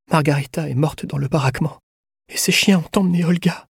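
high, fragile, distressed voice. A narrator's reading. Prisoner shudders, reports a death.

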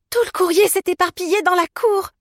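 very high, loud alarm; shocked, high-pitched exclamation; horrified. A narrator's reading. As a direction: cry out in dismay at the disaster. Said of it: "en portant la main à sa bouche avec effroi".

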